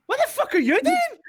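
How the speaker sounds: high, loud squawk of outrage; outraged captives. Acted. Game master voices captives splashed with holy water, outraged shriek. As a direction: shriek it as the indignant prisoners. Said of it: "they all just kinda went".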